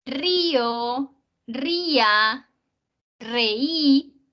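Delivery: Río, ría, reí with a long stressed í; two-syllable hiatus. Shown as a demonstration. Teacher listing words that are not monosyllables, Río, ría, reí drawn out. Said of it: "no son monosílabos".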